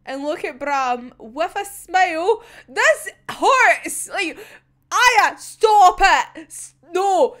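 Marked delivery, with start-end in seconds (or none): 2.7-7.4 loud, higher, drawn-out plea to stop; distressed outcry. Real, not acted. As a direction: beg the character to stop, louder and slower. Pleading with a character to stop.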